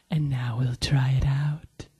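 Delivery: slow, sly whisper; conspiratorial whisper. Retold or imitated. Friend unveiling the finished voodoo doll, conspiratorial. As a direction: whisper it slowly like a schemer. Johnny's sly plan, retold.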